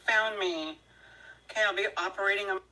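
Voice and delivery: ordinary talk, nothing marked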